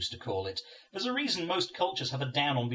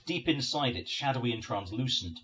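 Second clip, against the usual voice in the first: plain reading voice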